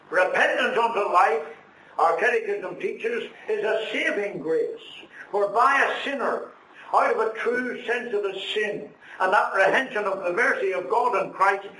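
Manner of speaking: catechism recitation. Acted. Preacher recites the catechism to the congregation, declaiming.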